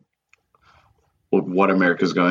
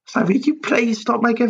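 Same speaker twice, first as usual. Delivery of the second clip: mock pleading North Korea; 9 semitones higher than usual. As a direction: beg in a silly high voice while laughing. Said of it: "when North Korea sends me a message".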